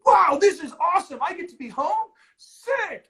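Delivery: playing the giddy early-quarantine mood; mock excitement. Early in quarantine, a couple gushing about staying home, mock excited.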